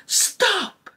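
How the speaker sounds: high-pitched mock cop shout; retold police order. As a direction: call out a sudden halt, imitating a cop. Cops suddenly halt the girls.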